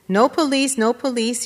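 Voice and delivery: imploring. A narrator's reading. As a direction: implore them to drop it. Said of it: "he heard his wife imploring someone".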